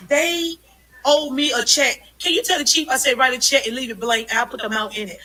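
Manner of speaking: loud, heated protest at a press event; elevated voice. Real, not acted. Reporter denied access loudly demands a blank check. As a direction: raise your voice, demanding to be heard. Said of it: "my voice is elevated a little bit".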